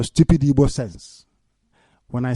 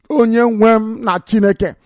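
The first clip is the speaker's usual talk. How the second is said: higher, fuller cry to God; raised invocation; 5 semitones higher than usual. Real, not acted. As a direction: lift the voice to call on your Lord. Repeats the call, raised.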